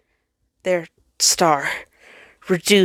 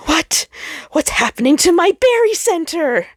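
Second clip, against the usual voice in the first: much higher, startled voice, louder at the end; a panicked planet crying out; alarmed planet; 11 semitones higher, 5 dB louder than usual. Acted.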